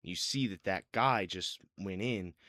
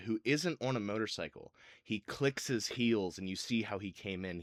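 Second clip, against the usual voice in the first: ordinary talk, nothing marked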